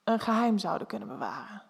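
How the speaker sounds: softly voiced, close to a whisper; almost whispering. A narrator's reading. Man confiding to the narrator about secrets, almost whispering. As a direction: drop softly towards a whisper. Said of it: "bijna fluisterend".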